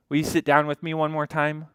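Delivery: ordinary talk, nothing marked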